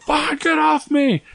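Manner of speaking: high, strained cry to get them off; desperate mock yell. Boy pinned by kids, high desperate yell. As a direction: shove them away with a yell. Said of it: "I was like".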